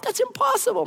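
high, sing-song that's impossible; stunned disbelief. Pretend. Heir hearing the palace news, stunned disbelief. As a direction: gasp it out in disbelief.

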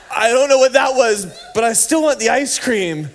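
put-on high, loud kid's protest; bewildered toddler. Toddler to dad after the blood test, high and insistent. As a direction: imitate a rattled but insistent child.

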